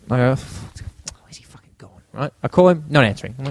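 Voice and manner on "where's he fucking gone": hushed, breathy whisper to himself. Retold or imitated. Telling the podcast hosts how he lost Ash, whispered. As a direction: mutter it under your breath, barely voiced. Teller retells looking for Ash.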